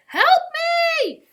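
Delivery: high, loud drawn-out yell; full-voiced distress call; yelling for help. A narrator's reading. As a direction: yell across water to a ship. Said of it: "he yelled".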